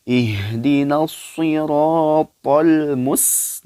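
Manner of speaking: Quran recitation. Acted. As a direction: chant the verse slowly like tilawah. Recites verse. (bacaan tilawah pelan dan lantang)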